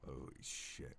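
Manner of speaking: real awed whisper; whispered shock. Real, not acted. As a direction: barely voice it, stunned. Player stunned by lore reveal.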